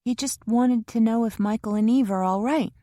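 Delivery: Kara anxious in the waiting room; young Kara voiced. A narrator's reading. Kara's voice in the novel.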